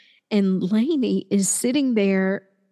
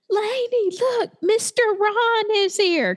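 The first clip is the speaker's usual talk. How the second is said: put-on high, excited little girl calling out; child announcing her favorite grown-up; 13 semitones higher than usual. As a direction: squeal it like a thrilled preschooler. Child voiced in retold story.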